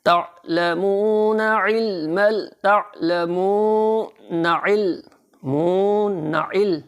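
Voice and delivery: تعلمون علم run together with no pause; slow joined Quran reading; joined reading demo. Shown as a demonstration. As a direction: link the words تعلمون علم smoothly. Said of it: "اب اس کو اکٹھا کیسے پڑھنا ہے".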